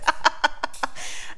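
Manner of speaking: silent wheezing laugh; breathy laugh. Real, not acted. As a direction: let the laugh leak out. Still laughing.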